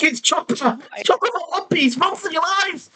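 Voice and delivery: high, rising mock panic cry; panicked shriek, half laughing. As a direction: squeal like you're fleeing a monster. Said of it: "I was like".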